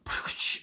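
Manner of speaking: whooshing whip noise with the mouth; spat-out "pchh" whip effect; imitated whip crack. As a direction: make a quick whip-crack sound. Imitates whipping the bra off.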